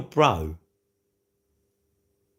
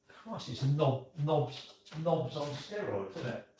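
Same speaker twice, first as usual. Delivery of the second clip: muttered aside; 9 dB quieter, slower than usual. Real, not acted. Muttering insults quietly.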